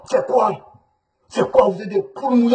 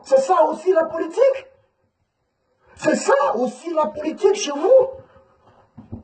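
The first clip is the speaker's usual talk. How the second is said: indignant questioning; 7 semitones higher than usual. Real, not acted. Indignant challenge to the regime.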